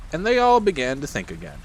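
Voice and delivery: ordinary delivery, nothing marked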